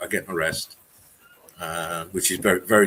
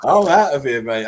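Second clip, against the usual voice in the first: laughing; 8 semitones higher, 6 dB louder than usual. Real, not acted. Joking about leaving while laughing.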